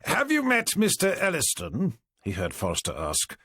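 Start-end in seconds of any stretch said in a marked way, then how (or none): 0.1-2.0 put-on older superintendent voice. A narrator's reading. Forrester's voice, superintendent.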